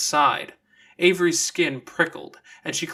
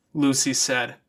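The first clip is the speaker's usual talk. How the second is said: ordinary delivery, nothing marked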